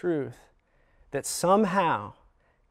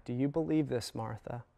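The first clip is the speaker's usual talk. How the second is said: gentle question; 8 dB quieter than usual. Acted. Jesus asks Martha gently.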